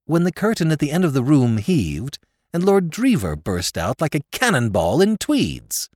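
plain reading voice

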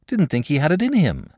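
ordinary delivery

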